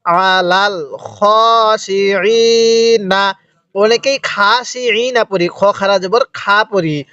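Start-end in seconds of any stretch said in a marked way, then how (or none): 0.1-3.3 ওয়াসসালাতি drawn out slowly and articulated clearly; careful recitation. Shown as a demonstration. Teacher teaching the word, slow careful recitation. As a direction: model ওয়াসসালাতি slowly for the class. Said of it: "কিভাবে পড়ব?".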